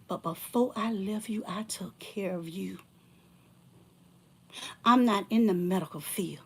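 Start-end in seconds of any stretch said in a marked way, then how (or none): 0.1-2.8 hushed reproach. Real, not acted. Reminding the ex she nursed him, whispered.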